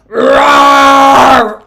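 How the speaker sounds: long, very loud shouted roar; dragon roar. Pretend. Reader channeling dragon energy with a huge roar. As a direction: let the dragon out. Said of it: "let's channel some dragon energy".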